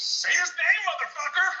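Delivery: high, loud hammed-up yell of an angry wife; mock furious demand.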